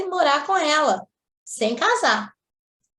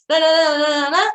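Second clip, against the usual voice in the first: mock blah blah; 5 semitones higher, 6 dB louder, slower than usual. Mocking blah-blah of the rant.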